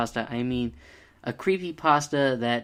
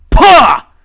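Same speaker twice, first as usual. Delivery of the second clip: very loud, much higher "pa!" blasted out; ear-splitting shout; 13 semitones higher, 21 dB louder than usual. Retold or imitated. Mocking Gordon's voice actor with a deafening "pa!". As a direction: bark "pa!" as loud as you can. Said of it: "cover your ears, people".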